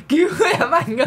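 high laughing fake cry for help; mock plea for help. Gamer pretends to beg big brother to save him, laughing high. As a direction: squeal it like a helpless little one. Begging for rescue in game.

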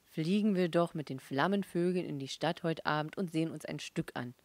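ordinary delivery

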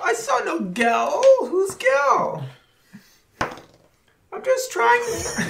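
put-on high, frail old beggar voice; old blind man voice. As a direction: play a lost, helpless old blind man. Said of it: "the whole blind guy thing".

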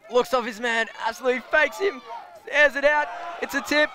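builds from calm call to excited high call; rising excitement. Real, not acted. Commentator calling a long throw to viewers, rising excitement.

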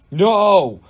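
loud, high storybook scream; drawn-out narrated scream. A narrator's reading. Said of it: "screamed Galen".

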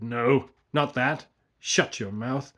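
loud, slow command; ordering. A narrator's reading. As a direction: bark the order. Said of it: "He ordered".

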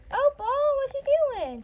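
very high sing-song question; pet talk. Real, not acted. Owner catches Opal at something, high pet-talk voice.

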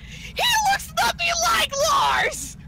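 high, slow, exasperated yell; retold yelling. Storyteller retells yelling at Breakfast Tea about the husband, exasperated yell. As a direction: yell it high, dragging out each word. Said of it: "No, I'm yelling at Breakfast Tea".

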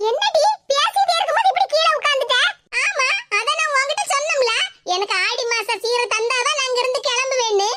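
high, defiant grown daughter sitting in protest; stubborn daughter voice. Acted. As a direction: play the sulky daughter digging in her heels. Daughter holds high put-on voice.

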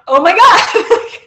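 high, loud, laughing disbelief; delighted squeal. Real, not acted. Author telling host narrator speaks Russian, laughing squeal.

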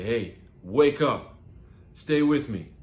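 loud, high plea to stay conscious; urgent rousing. Acted. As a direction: shout to keep someone from slipping away. Rouses dying crash victim.

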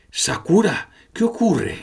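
high, concerned question in a put-on voice; lightly voiced engineer. Lid gets up and asks Sakura what is wrong, in a high concerned voice. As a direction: ask with sudden worry.

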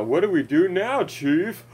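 pleading mock sidekick voice; whiny pilot voice. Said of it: "you know".

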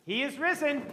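high, loud Easter acclamation; joyful proclamation. Real, not acted. Pastor to congregation at Easter, proclaiming loudly. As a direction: proclaim it out to the whole church.

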